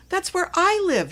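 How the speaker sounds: high, loud, bouncing with excitement; can't believe her luck, squeal it out; a girl discovering her friend is her neighbor; thrilled disbelief. A narrator's reading. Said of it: "Cookie couldn't believe it".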